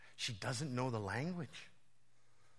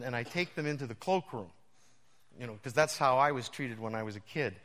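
normal talking voice

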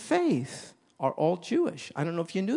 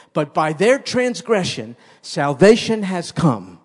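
loud, slowing pulpit voice; preacher swelling on salvation; fervent preaching; 3 semitones higher, 9 dB louder than usual. Acted. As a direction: build to the climax. Preaching at full force.

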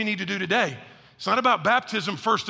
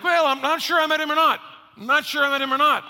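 mock unsure; 7 semitones higher, 4 dB louder than usual. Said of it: "and it's like".

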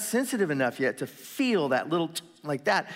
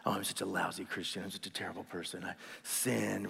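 low, soft mock moping; downcast self-reproach; 7 semitones lower, 11 dB quieter than usual. Believer berating himself on the sidelines, downcast mumble. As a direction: mope it quietly, pitched down.

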